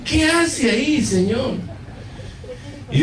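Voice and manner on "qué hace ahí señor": very high, mock-anguished question; comic high-pitched protest; retold desperate cry to God. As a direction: wail the complaint up to heaven. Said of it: "llorando, y diciendo al Señor, pataleando".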